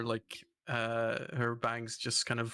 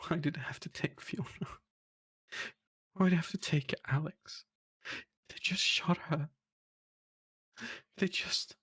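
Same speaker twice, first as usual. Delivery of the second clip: higher, whispery broken sobs; sobbing; 5 semitones higher than usual. Acted. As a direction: choke out grief in halting, whispered bursts. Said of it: "you can hear the little sobbing again".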